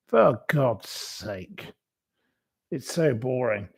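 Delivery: ordinary talk, nothing marked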